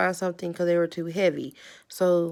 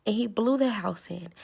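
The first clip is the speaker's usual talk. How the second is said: ordinary delivery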